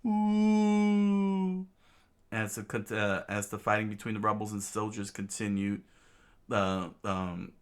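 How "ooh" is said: long, high "ooh"; sustained high-pitched whoosh; whistling wind noise. Acted. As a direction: imitate wind with a high hoot. Imitates winds as shot flies.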